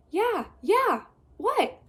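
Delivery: high, loud and annoyed; impatient yell. Acted. Trapped girl answering boy, high annoyed yell. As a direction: snap back, bored.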